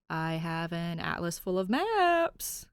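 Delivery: slow, deliberate bait; slow temptation. Acted. Cop tempting Danny, slow and deliberate. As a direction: dangle the offer slowly. Cop's clinching slow pitch.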